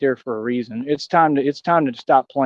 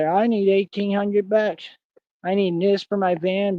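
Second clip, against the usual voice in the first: higher, slow whiny plea; mock begging; 5 semitones higher, slower than usual. Mocking Mister Wofford begging viewers for van money. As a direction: ham up a begging voice asking for cash.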